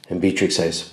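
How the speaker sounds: ordinary talk, nothing marked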